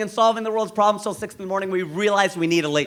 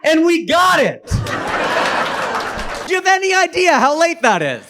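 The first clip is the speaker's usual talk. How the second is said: high, loud, slow and laughing; excited laughing shout; 10 semitones higher, 4 dB louder, slower than usual. Real, not acted. Comic tells the crowd they got the late checkout, laughing excitedly. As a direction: shout it out in triumph. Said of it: "triumphant laughing shout".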